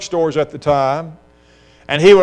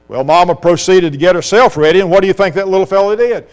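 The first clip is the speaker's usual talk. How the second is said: ordinary talk, nothing marked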